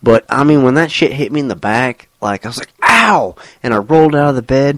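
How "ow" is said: sharp high ow; pained yelp. Retold or imitated. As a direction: yelp in sudden pain. Woken by fart, retold yelp.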